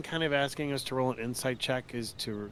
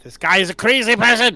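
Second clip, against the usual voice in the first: loud alarmed shout; 10 semitones higher, 19 dB louder than usual. Acted. Player voicing character in alarm.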